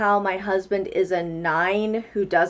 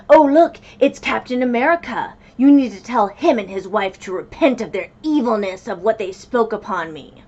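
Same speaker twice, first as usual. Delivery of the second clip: loud sneering mock voice, pitched up, mocking a pompous commenter; mocking quote; 2 semitones higher, 7 dB louder than usual. Vlogger mocks her online critic: loud sneering mock voice. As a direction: ham up a smug, preachy troll. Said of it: "Kevin Samuels would be in the comments being like".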